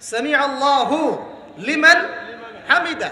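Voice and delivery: "সামি আল্লাহু", "লিমান", "হামিদা", with pauses between; correct word division. Shown as a demonstration. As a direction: teach it piece by piece, keeping "হু" on "আল্লাহু". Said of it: "বলে কোনো শব্দ আছে". ("সামি আল্লাহু / লিমান / হামিদা" ভেঙে ভেঙে শুদ্ধ উচ্চারণ)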